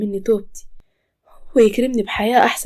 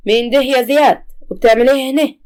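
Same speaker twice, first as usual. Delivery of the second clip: raised, louder scolding demand; an angry mother confronting her son; mother's angry challenge, higher and louder; 5 semitones higher, 5 dB louder than usual. Acted. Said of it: "وبتقول بعصبية".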